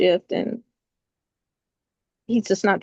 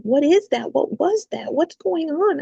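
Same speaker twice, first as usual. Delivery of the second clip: put-on scared child; 7 semitones higher than usual. Son voiced in retold scare.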